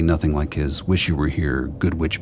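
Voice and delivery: ordinary delivery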